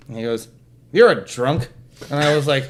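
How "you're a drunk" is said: father's blunt verdict. Retold or imitated. Retelling father's blunt accusation.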